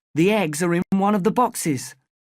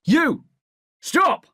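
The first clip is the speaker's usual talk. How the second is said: shouting; 11 semitones higher than usual. A narrator's reading. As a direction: bark it as an order. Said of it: "he shouts to the other smuggler".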